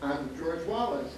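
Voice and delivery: ordinary delivery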